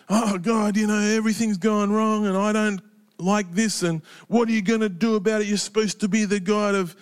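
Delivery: high, whiny rant at God in one unbroken rush; grumbling, high-pitched babble; grumpy babbling prayer. Acted. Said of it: "He could have had a big grumpy with God".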